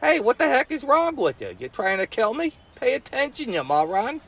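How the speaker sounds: Johnny hollering up at his pump-minding brother, mock yell. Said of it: "pop up out of the water and yell at him".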